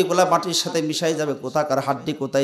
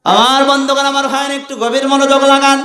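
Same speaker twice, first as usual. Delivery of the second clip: high, loud fiery preaching; fervent high sermon delivery; preaching at full force; 10 semitones higher, 7 dB louder than usual. Acted. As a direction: hammer it home to the crowd.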